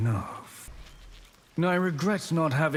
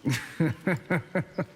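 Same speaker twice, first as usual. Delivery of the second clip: a short, sly villain's laugh; sinister chuckle; 2 semitones higher than usual. Acted. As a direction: chuckle darkly as if the threat amuses you. Villain amused by threat.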